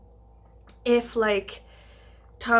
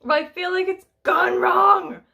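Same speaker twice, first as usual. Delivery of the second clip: high, loud, slow comic wail; hammed-up despair; mock dramatic; 9 semitones higher, 7 dB louder than usual. Real, not acted. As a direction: lament it like a disaster. Comic verdict on the Welsh accent.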